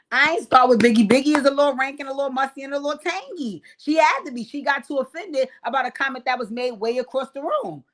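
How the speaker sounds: loud, high-pitched protest; heated self-defense. Real, not acted. Host defending herself to brother in chat, heated. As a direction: argue back with rising indignation.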